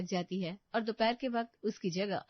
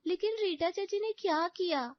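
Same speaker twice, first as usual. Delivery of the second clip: put-on high young girl voice; small girl pleading for a reason; protesting daughter; 8 semitones higher than usual. A narrator's reading. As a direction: innocent child's puzzled question, high. Daughter questions the ban.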